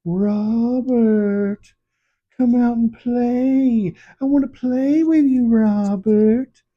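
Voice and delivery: high, slow sing-song call, drawn out; creepy high-pitched playground taunt; taunting vampire. Acted. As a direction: lure him out like a vampire at the door. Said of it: "who is like".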